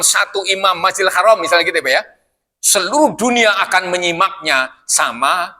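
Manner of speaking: ordinary delivery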